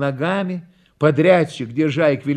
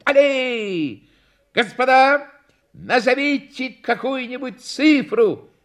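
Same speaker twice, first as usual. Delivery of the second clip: high, projected circus call; clown showman; 9 semitones higher than usual. A narrator's reading. Clown asks the audience for a number, showman. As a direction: announce it to the audience, high-pitched.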